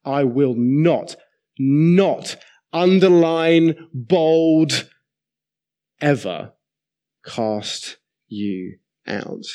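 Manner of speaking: slow and loud, building through "not, underline, bold", then easing slowly down; drawn-out emphasis, peaking loud and high on "underline, bold"; emphatic repetition. Shown as a demonstration. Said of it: "In case you didn't quite hear it".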